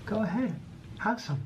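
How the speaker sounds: high coaxing pet voice; pet talk. Real, not acted. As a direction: coax the dog to eat. Offering food to pet.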